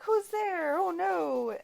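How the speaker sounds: high, hammed-up frightened squeak; a jokey, lightly frightened yelp; mock scared victim. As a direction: play the scared guy in the rain, overdone.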